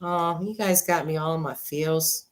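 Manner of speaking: ordinary talk, nothing marked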